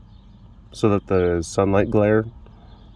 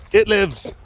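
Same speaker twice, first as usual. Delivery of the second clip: high-pitched mock-dramatic cry; playful theatrical announcement; dramatic exclamation; 9 semitones higher than usual. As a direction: proclaim it like a monster come to life. Mock-dramatic cry on seeing animal.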